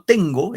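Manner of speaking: teacher stressing "tengo" on TEN. Shown as a demonstration. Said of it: "el acento va en ten".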